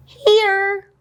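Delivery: put-on high, small child's voice; student says here; kid answering roll. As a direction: pipe up like a little kid.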